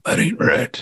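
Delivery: a Sam Elliott-style grizzled voice; old prospector. Acted. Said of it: "work on my, what's his name ... Sam Elliott".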